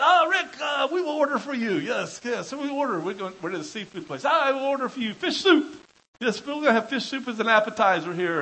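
high, loud, eager Serbian host; Radovan. Retold or imitated. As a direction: play an insistent host ordering for a guest. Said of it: "Radovan said".

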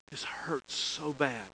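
whispered, slow and hushed; retold tearful whisper. Heartbroken student confiding in her campus minister, whispered. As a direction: breathe it out as a whispered hurt. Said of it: "she would say that in between the tears".

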